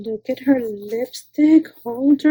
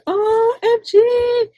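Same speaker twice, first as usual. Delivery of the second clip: spelled-out excited OMG; 11 semitones higher, much slower than usual. Real, not acted. Gushing over a gift.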